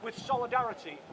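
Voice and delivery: measured public statement read aloud; formal legal reading. Acted. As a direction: deliver it like a prepared press statement. Reads prepared statement to press.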